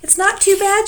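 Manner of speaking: very high, loud shriek. A narrator's reading. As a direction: shriek it over the storm. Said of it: "shrieked the dragon".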